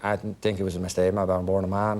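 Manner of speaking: low Dublin mumble; Brenda Fricker. Retold or imitated. Acting opposite him in rehearsal, low Dublin drone. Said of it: "I'm acting with Brenda Fricker, and she's like".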